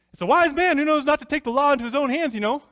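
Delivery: high, loud, fast officious deputy voice; official tone. Retold or imitated. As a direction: puff up like a self-important deputy laying down the law. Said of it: "pipes up in his official tone of voice".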